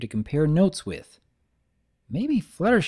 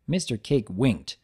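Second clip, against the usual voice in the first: plain reading voice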